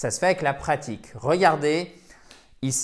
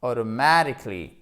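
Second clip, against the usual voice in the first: automatically with light weight on AU, main stress on MA; secondary stress at start; 2 semitones lower, 4 dB louder than usual. Shown as a demonstration. Teacher showing how secondary accent supports the word, stressed AU and MA. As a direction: press a little on the start of "automatically", then hit "MA". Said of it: "on va mettre une petite pression".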